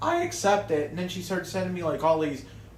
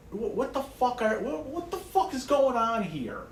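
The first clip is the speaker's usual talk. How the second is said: incredulous retelling; 7 semitones higher than usual. Retold or imitated. Retold shock at mom's threats.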